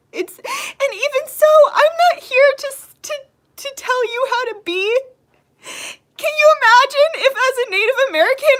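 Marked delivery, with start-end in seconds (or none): 0.1-2.7 crying. Real, not acted. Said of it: "That's why I'm crying right now". 6.2-8.6 higher and louder through tears; crying, raised. Real, not acted. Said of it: "That's why I'm crying right now".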